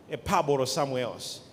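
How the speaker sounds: ordinary talk, nothing marked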